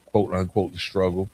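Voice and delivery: usual speaking voice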